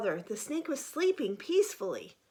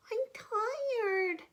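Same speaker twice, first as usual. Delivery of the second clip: whiny tired frog; 10 semitones higher than usual. Acted. Young frog whining.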